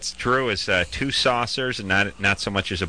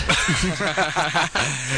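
laugh; 6 semitones higher than usual. Real, not acted. Laughing at his own joke.

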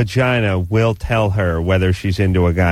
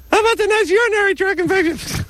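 ferret voice. Pretend. Vagina puppet threatens woman.

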